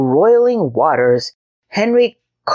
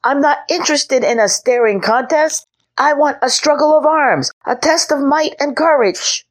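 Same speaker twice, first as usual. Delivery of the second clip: put-on a six-year-old knight declaring battle; boyish knight's proclamation; 10 semitones higher than usual. A narrator's reading. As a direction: proclaim like a little boy playing knight. Henry insists.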